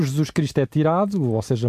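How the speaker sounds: normal talking voice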